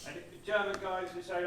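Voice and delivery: ordinary talk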